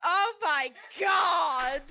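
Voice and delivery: high, drawn-out cry of disbelief; loud exclamation. Real, not acted. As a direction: wail it out to the table. Player exclaims amid table laughter.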